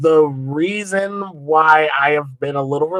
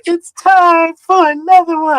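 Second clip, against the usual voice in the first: high, loud, drawn-out kiddie voice; very high, slow mock child voice; imitating little kids; 7 dB louder, slower than usual. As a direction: mimic cute kids belting out a catchphrase. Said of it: "All cute little kids and stuff".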